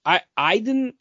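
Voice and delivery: ordinary talk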